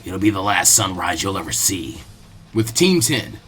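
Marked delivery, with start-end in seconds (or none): 0.0-2.0 lower, quieter menacing mutter; low grumble. Acted. Zabuza grumbling from his hiding spot, low. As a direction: growl it under your breath. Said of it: "grumbled to himself lowly".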